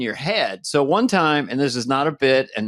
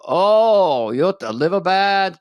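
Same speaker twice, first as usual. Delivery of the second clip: a bad Norwegian accent, lightly put on; playing an acupuncture doctor in a mock Norwegian accent; 4 semitones higher than usual. Doctor reading his pulse, in a bad Norwegian accent. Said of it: "I'm doing a Norwegian accent, just a bad one".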